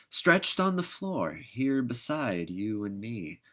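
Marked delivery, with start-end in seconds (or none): none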